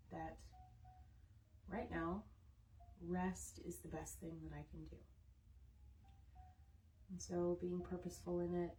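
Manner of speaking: unhurried, soothing centring voice; guided meditation. Real, not acted. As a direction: settle them gently, with long restful pauses. Leads a calming centring before stretching.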